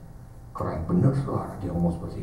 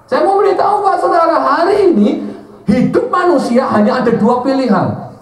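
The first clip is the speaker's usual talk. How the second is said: loud, high, shouted sermon; fiery preaching; 9 dB louder than usual. Acted. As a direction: preach it at full force to the congregation. Preaching at full force.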